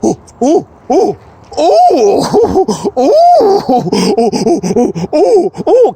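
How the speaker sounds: high hooting monkey calls with laughter. Shown as a demonstration. Vlogger shows his friend the monkey voice, hooting. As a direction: hoot like an excited monkey, jumping up in pitch. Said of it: "we're gonna do it in monkey voice".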